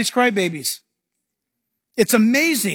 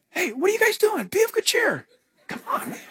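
high, soft, easygoing call; breezy greeting while strolling on water; lightly voiced relaxed Jesus; casual Jesus; 11 semitones higher, 4 dB quieter than usual.

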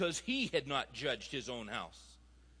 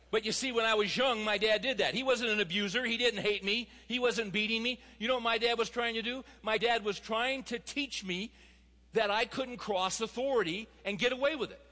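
higher-pitched, emphatic sermon delivery; impassioned preaching; 6 semitones higher than usual. Real, not acted. Preacher urging fathers to represent God, impassioned. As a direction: drive the point home to the congregation.

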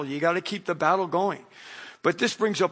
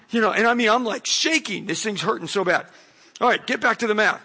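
ordinary talk

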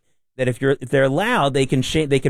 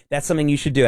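ordinary talk

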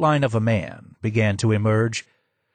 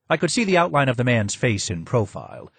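usual speaking voice

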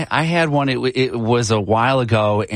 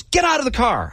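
mock angry cop, much higher; angry cop order; 13 semitones higher than usual. Cop orders the driver out, imitated angry and high. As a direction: bark it like a cop having a bad day. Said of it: "he was so angry".

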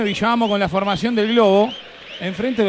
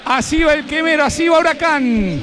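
hyped team intro; 5 semitones higher than usual. Acted. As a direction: belt it like a stadium announcer. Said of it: "Con esta presentación".

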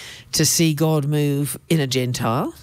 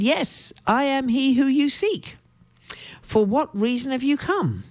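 higher, formal Peter answering; 9 semitones higher than usual. Acted. Peter answering the men at the gate, a lightly voiced formal tone. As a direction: greet them high and solemn. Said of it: "And said".

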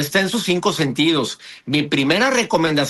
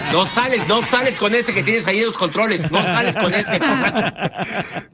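higher, lightly acted bossy wife; mock scolding wife; 4 semitones higher than usual. Imitating Piolín's wife forbidding him to go out, mock scolding. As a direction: play the wife laying down the law. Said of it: "Así le gritan a Piolín".

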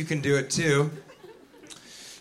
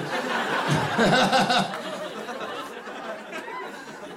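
high awkward forced laughter, trailing softer; fake laugh; 6 semitones higher, 5 dB quieter than usual. Wet-handed greeter laughing it off, awkwardly.

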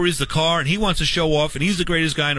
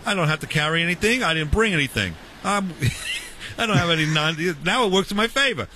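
ordinary delivery

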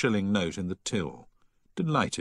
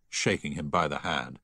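ordinary delivery, nothing marked

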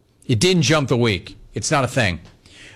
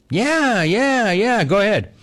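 high, drawn-out yeahs; brusque go-ahead; 8 semitones higher, slower than usual. Retold or imitated. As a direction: wave him in, higher-pitched. Players waving him into seat.

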